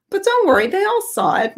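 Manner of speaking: high-pitched imitation of a pleased mother; delighted mother. Mother telling daughter her friends saw it, delighted.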